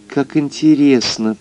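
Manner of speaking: high chirpy ladylike voice; chirping. Acted. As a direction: twitter it like a songbird. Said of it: "прощебетала госпожа Мозес". (щебечущий высокий голосок)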